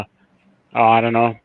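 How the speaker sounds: ordinary talk, nothing marked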